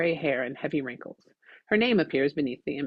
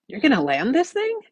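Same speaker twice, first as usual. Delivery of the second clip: alarmed disbelief; 10 semitones higher, 6 dB louder than usual. Acted. Aaron alarmed at landing.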